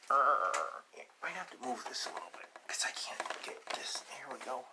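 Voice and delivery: whispered aside. Real, not acted. Aside while moving the book.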